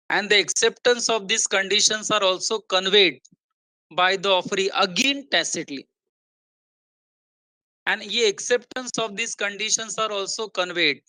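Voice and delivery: slow, deliberate teacher's dictation pace; very slow reading. Real, not acted. Teacher reading the new material line by line, very slowly. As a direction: read it out very slowly, spacing the words. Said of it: "ये एकदम slow जाऊंगा मैं".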